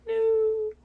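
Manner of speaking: sudden high loud cry of No; a scared high yelp; startled shout. Real, not acted. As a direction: blurt it out in a fright. Alone at night, scared.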